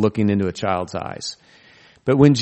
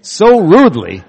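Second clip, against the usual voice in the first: so rudely laughed out loud and higher; laughing emphasis; 6 semitones higher, 8 dB louder than usual. Shown as a demonstration. Preacher laughing at Jesus' rude reply, so rudely said louder. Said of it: "I'm glad this isn't a Mother's Day sermon".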